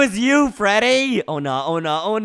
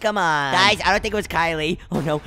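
ordinary delivery

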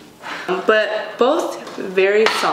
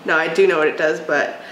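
ordinary talk, nothing marked

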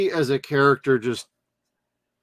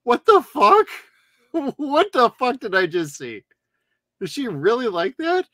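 mock disbelief; 9 semitones higher than usual. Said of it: "I'm like".

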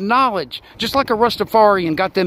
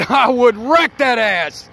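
higher, slower, laughing through it; I would wreck that ass said higher and slower with a laugh; laughing boast; 6 semitones higher, 3 dB louder, slower than usual. Acted. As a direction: crack yourself up on the repeat.

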